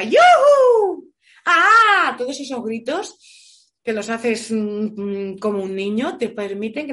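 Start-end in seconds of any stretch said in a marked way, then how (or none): 0.0-1.1 un yujú agudo y muy fuerte, con alegría infantil; grito alegre de niño. Shown as a demonstration. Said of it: "como un niño desde la alegría". 1.4-2.1 un ah agudo y fuerte, suelto y feliz; grito alegre de niño. Shown as a demonstration. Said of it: "como un niño desde la alegría".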